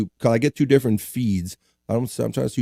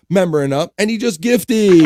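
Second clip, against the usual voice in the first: excited hype announcement; 7 semitones higher, 5 dB louder than usual. Real, not acted. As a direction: climb to a loud, high, stretched shout. Host hypes a gifted membership.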